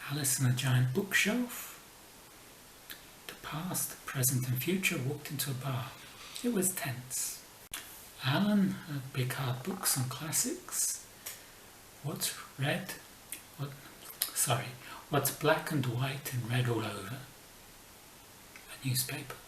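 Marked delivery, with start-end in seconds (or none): none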